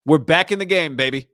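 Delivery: loud hyped-up boast, imitating a gloating owner; mock excited boast. Fans imagine the owner bragging, loud hyped-up boast. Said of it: "thought he was saying".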